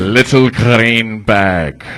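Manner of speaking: very slow Russian accent, hard syllables. Shown as a demonstration. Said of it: "go hard on the syllables, and then you roll the R's".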